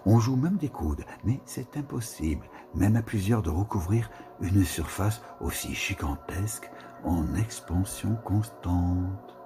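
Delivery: ordinary delivery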